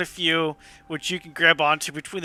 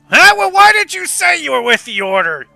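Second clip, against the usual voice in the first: put-on exasperated old boatman; 2 semitones higher, 8 dB louder than usual. Old boatman scolding passengers for not naming the Order, loudly exasperated. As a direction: scold them as a cranky old man. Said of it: "Thomas goes".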